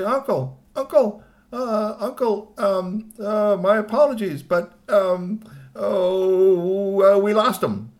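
high, halting, apologetic whine; nervous confession. Acted. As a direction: stall and squirm before admitting the bad news. Nephew nervously confesses to his uncle.

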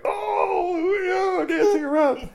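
very high, loud happy squeal; gleeful cubs. Acted. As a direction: squeal with glee. Happy little bears.

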